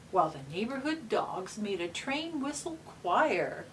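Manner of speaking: gentle storybook reading; reading to children. Acted. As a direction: read it like a bedtime picture book. Reads picture book aloud.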